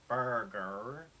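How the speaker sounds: burger in a Southern American accent, hard R's. Shown as a demonstration. Practising an American accent for a friend, Southern burger. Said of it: "It is kind of Southern".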